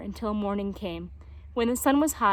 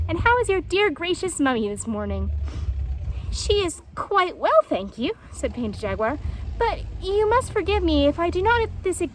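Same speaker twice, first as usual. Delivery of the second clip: lightly voiced animals, polite; 7 semitones higher, 4 dB louder than usual. Jaguar and hedgehog dialogue.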